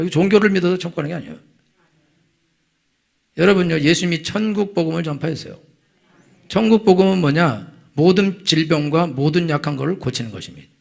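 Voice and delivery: loud, driving sermon voice; fervent preaching. Acted. Pastor exhorting the congregation, loud and fervent. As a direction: hammer the point home to the flock.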